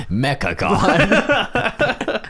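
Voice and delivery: very slow, high announcer; slow dramatic announce. Pretend. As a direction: stretch it out like a title. Drawn-out joke name.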